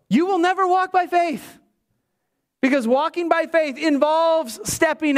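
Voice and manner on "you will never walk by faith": higher, punched preaching line; emphatic sermon punchline; preached emphasis. Real, not acted. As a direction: drive the point home from the pulpit. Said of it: "preacher punches the quote's end".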